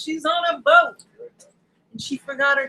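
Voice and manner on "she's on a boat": high-pitched mock announcement; hammed-up high exclamation; playful catchphrase. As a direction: repeat it like a silly hook.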